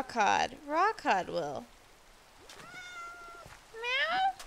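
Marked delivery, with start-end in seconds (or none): 3.7-4.3 high imitation meow; mock meow. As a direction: meow up at the cat. Meowing at the cat.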